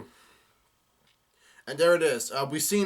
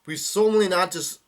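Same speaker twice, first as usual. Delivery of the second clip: raised, higher voice; mild yelled vow; 6 semitones higher, 7 dB louder than usual. A narrator's reading. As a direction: read it as a moderately loud declaration. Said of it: "And Bartholomew yells out".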